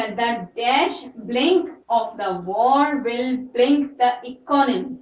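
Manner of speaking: slow, saying blink where the gap goes; slow dictation with blanks. Shown as a demonstration. Teacher dictating a fill-in-the-blank sentence, slowly. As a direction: read the exercise slowly. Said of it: "fill in the blank".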